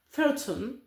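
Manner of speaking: "Thornton" said the English way, with a light self-mocking English accent; an English accent put on just for "Thornton". Shown as a demonstration. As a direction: give "Thornton" a playful English-accented reading. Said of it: "avec mon super accent".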